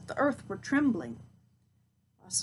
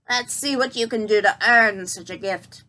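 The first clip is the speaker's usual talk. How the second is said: cackling witch; 7 dB louder than usual. Acted. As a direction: sneer it like a wicked hag. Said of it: "cackled the old crone".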